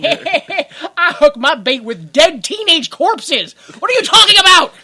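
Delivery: loud, high, building to a yell; incredulous comic bellow; mock outraged retort. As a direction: protest at the top of your voice, hammed up. Acted outraged retort to the cops.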